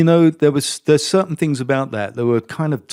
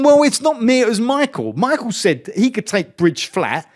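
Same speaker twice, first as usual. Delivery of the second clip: high-pitched, hurried-to-blame excuse; acted defensive protest; 11 semitones higher, 4 dB louder than usual. Driver caught out by telemetry, protesting defensively to engineer.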